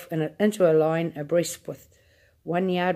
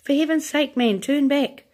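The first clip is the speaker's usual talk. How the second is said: very high frightened begging; panicked plea; 9 semitones higher, 4 dB louder than usual. A narrator's reading. Grandma begging Wonka to turn back, very high panic. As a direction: beg him to turn in terror.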